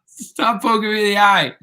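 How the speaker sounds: high pitched mock complaint; mock protest. Streamer protests the eye poke in a high mock whine. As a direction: whine it high, half joking.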